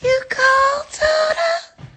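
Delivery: put-on very high, cooing baby-talk voice; sing-song high baby talk; baby talk to meat. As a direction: coo at the thawing meat like a pet.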